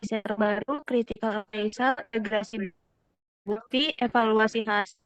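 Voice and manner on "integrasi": ordinary talk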